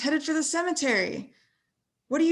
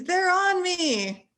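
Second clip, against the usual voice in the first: usual speaking voice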